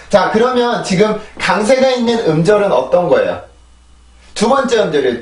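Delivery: ordinary talk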